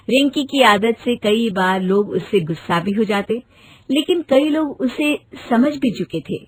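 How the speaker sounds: ordinary talk, nothing marked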